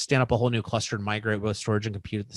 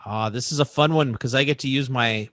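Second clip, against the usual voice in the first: ordinary talk, nothing marked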